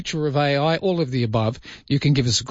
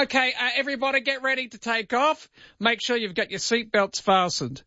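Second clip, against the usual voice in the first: much higher, hesitant cabin announcement; unconvincing pilot voice; 11 semitones higher, 3 dB quieter than usual. Acted. Pretend pilot tells passengers to get ready, high-pitched unassured voice. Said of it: "this person that's there would go".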